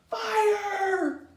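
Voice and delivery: high, drawn-out alarm cry; panicked yell. A narrator's reading. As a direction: yell the alarm as a frightened father. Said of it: "yelled Timothy's father".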